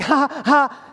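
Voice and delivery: put-on laugh at someone's mishap; mock laugh. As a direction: imitate gloating laughter. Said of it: "have a feeling and think".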